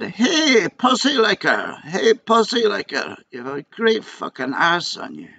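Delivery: put-on high, slow drunken heckling; drunk man roaring. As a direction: bellow it like a drunk on the bus. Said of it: "roaring and shouting from the back".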